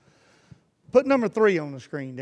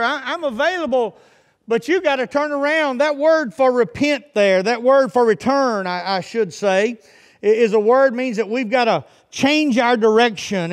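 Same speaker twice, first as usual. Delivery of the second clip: raised, insistent pulpit voice; fervent preaching; 7 semitones higher, 9 dB louder than usual. Real, not acted. Preacher urging his congregation back to God, fervent preaching. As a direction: urge them to turn around, pitched up.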